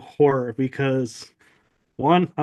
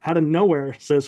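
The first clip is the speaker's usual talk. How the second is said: normal talking voice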